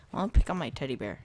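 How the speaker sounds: ordinary delivery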